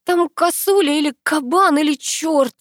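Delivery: high, frantic report; panicked. A narrator's reading. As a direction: blurt it out in fright. Panicked girl explains her scream.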